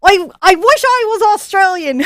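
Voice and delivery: laughing, shouted attempt at an Australian accent. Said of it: "give me your best Australian accent".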